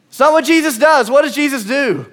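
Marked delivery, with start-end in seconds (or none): none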